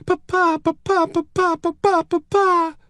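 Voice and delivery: put-on high child's voice repeating in panic; very high, frantic run of cries; gasping, frantic. A narrator's reading. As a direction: cry it over and over like a terrified little girl. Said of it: "Eloise gasped".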